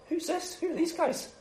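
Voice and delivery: high, small, hushed demon voice; unimpressed demon. Acted. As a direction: ask softly in a squeaky put-on voice. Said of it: "The demon is just like".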